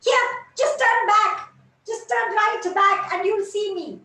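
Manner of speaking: high, loud, delighted calling; excited call. Acted. Jasmine eagerly calling the dewdrop over, delighted. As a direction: call out joyfully to draw them close.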